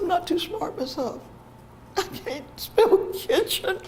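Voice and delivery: high, small confession from a pupil; upset child. Retold or imitated. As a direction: retell it high-pitched, close to tears. Retold child confessing, near tears.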